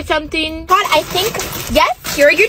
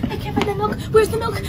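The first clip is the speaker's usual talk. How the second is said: put-on worried kid; 5 semitones higher, 5 dB quieter than usual. Lost child in supermarket.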